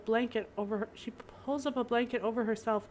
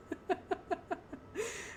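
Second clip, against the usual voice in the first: laughing; 9 semitones higher, 9 dB quieter than usual. Real, not acted. Breaks up laughing mid-reading.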